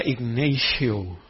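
"Ignacio" said slowly the English way, as ig-NAY-shee-oh; English-style name. Retold or imitated. Fred Price calling the preacher's name, English pronunciation. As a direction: drawl "Ignacio" with an American vowel and sh sound. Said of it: "qué sofisticado se oía mi nombre".

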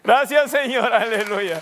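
loud, high praise shouting; rally the church with a cry of praise; loud pulpit praise and exhortation; preaching at full force. Acted.

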